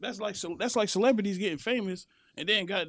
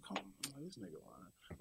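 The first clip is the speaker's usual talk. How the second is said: very quiet, low imitated aside; doubting aside; 7 semitones lower, 23 dB quieter than usual. Woman hearing a man claim he's in love, doubting aside. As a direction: drop it right down, as if to yourself.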